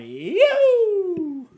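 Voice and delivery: long, very high "yoo" whoop; loud falsetto holler held out. Pretend. Whooping right after saying sorry, high whoop.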